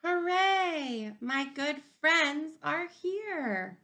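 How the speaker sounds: high, loud cheer, then slow delighted; joyful cheer. Acted. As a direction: shout it like your best friends just arrived. Amos greets arriving friends.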